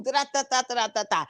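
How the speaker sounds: quick rattling da-da fight noises; punching sound effect. Retold or imitated. Vlogger acting out the fight with punch noises. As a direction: rattle off the punches like a cartoon brawl.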